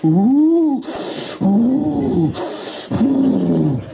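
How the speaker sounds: three loud puffed monkey oohs; monkey miming smoking. Acted. Monkey telling the sergeant about smoking, loud puffed hoots. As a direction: blow out long, separate oohs. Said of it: "The monkey says".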